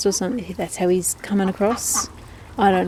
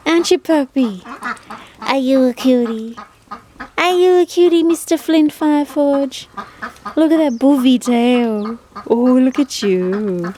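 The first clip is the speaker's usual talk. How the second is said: high sing-song voice cooing at the puppy; high pet-talk voice for the new rescue dog; 5 semitones higher, 3 dB louder than usual. Real, not acted.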